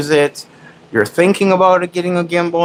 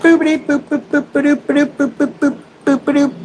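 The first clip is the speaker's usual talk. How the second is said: much higher squeaky booping; 13 semitones higher than usual. Real, not acted. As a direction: squeak every boop at the dog. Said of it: "baby talk my dog".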